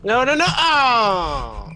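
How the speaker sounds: high, loud wailing no-no-no; alarmed cry. Real, not acted. Player wailing as a creeper gets him, alarmed cry.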